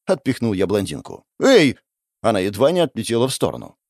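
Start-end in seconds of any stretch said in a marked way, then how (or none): 1.4-1.8 indignant yelp. Acted. Blonde shoved aside.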